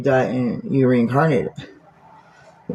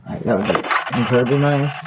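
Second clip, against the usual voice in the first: ordinary talk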